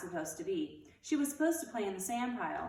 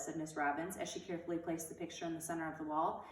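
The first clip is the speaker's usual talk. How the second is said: plain reading voice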